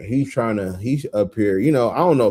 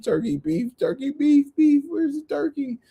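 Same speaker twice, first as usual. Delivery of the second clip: much higher, rising panicked searching; frantic menu search. Retold or imitated. Hunting the menu for turkey, high and panicky.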